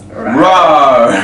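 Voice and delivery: deep growling roar. Acted. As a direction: roar it out like a panther. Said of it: "that rawr is just always so funny".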